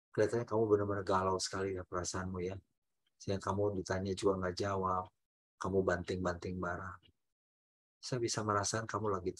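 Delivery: put-on gently name the child's feelings; empathetic calm. Shown as a demonstration. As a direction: parent calmly acknowledges the child's anger. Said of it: "itu mengenal perasaan di balik perilaku".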